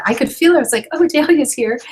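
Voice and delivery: light up with sudden delight; excited, high, loud exclamation; delighted surprise. Retold or imitated. Sensing daughter's spirit in kitchen.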